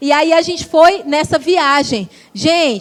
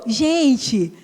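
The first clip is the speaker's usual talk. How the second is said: normal talking voice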